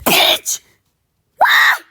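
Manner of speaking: sudden loud yell; a furious loud yell at the screen; angry outburst. Real, not acted. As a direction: bark it out full force. Outburst at game event.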